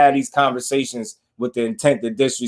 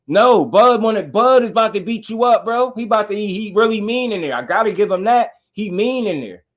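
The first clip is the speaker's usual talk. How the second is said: higher, urgent, insisting; worked up, pitch raised all through; excited insistence; 8 semitones higher than usual. Real, not acted. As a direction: press the point hard, voice up high. Excited insistence on Bud's menace.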